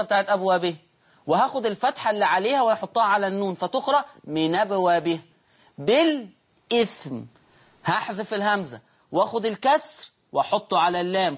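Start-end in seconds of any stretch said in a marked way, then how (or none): 5.8-7.2 بالإثم said slowly and higher, hamza dropped so the kasra moves onto the lam; naql demo. Shown as a demonstration. Teacher showing students how بالإثم is read, slow and high. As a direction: drag out بالإثم to show the hamza gone. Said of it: "فتقرأ بالإثم".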